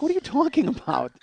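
high-pitched mock indignation; acted-out defensive squawk; indignant protest. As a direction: protest as if falsely accused. Retells protesting his wife's accusation.

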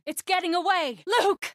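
high, alarmed cry; urgent high-pitched alarm; urgent calling out. Acted. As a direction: shout a warning in the chase. Calls out during a chase.

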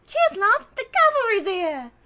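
put-on high, loud, chirpy British accent as Tracer; bright British accent, shouted high and loud; perky British accent as the hero Tracer. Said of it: "when I do Tracer".

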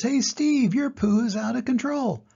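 high, loud warning call; hyena. Acted. Hyena warns Steve, high and loud. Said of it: "The hyena says".

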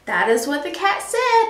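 usual speaking voice

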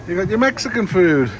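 usual speaking voice

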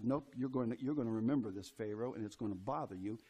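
low, soft God voice; a hushed, firm God talking to Pharaoh; voicing God. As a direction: speak for God, low and quietly insistent. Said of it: "God says".

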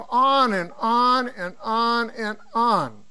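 slow, drawn-out repetition; dragging on. Retold or imitated. Lecturer recalling endless reading, slow and dragged out. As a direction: stretch each word as if it never ends.

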